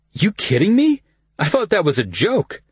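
high disbelieving voice; can't believe it, pitch up; surprised. A narrator's reading. Kyle's disbelief in dialogue.

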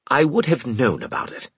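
sharp, defensive sneer; snide officer voice. A narrator's reading. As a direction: bristle at the suggestion. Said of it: "he snapped".

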